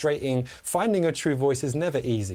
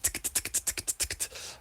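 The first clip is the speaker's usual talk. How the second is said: "ticketa" whispered fast, unvoiced; whispered triple tonguing; 12 dB quieter, faster than usual. Shown as a demonstration. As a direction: rattle off "ticketa" as soft clicks. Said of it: "like that".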